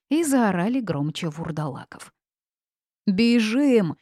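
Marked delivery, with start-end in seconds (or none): 3.0-4.0 loud, higher cry to flee; roared. A narrator's reading. Rilan flees the monsters toward the forest, roaring. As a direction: roar the order to run. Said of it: "взревел Рилан".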